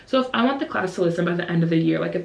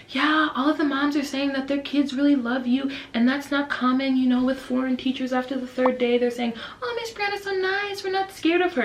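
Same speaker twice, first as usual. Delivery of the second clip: higher voice, lightly imitating a colleague; lightly voiced co-teacher, rising higher for the quote; co-teacher relaying praise; 6 semitones higher than usual. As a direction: pass on happy news in a raised pitch.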